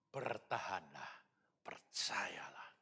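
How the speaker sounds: slow, breathy "percayalah" whispered; slow urgent whisper. Acted. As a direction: lean in and whisper the plea. Urging believers to endure.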